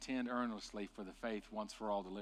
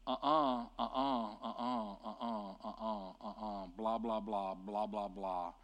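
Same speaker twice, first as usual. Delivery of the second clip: dismissive uh-uh; 3 semitones lower than usual. Retold or imitated. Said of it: "just saying".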